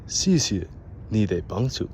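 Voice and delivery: ordinary talk, nothing marked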